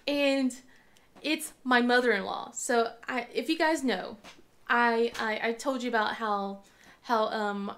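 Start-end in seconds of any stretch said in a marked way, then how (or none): none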